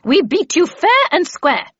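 high, loud, drawn-out indignation; angry retort. A narrator's reading. Havisham defends her bargain to the Red Queen, angrily. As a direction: hammer it home angrily. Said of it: "returned Miss Havisham angrily".

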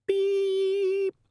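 long, very high machine beep; imitated beep. Answering machine cuts in on angel, sustained beep. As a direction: hold one piercing electronic tone.

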